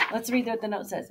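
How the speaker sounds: plain reading voice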